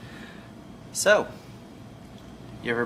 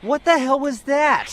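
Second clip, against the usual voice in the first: high, slow disbelief; can't believe what he heard; incredulous; 14 semitones higher, 5 dB louder, slower than usual. Real, not acted. Reacting to bad lie.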